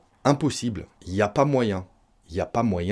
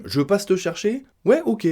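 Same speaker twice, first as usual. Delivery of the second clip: higher, bright-toned mock exchange; casual agreeing friend; 8 semitones higher than usual. Shown as a demonstration. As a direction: play the easygoing friend saying yes. Dialogue example, friend agreeing. (ami qui accepte, voix plus haute)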